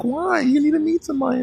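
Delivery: hammed-up high mother; pushy mom. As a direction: squeak out the mom's demand. Mom insisting.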